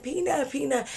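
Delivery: high-pitched mock wail; imitated crying friend. Friend crying through the car window, high mock wail.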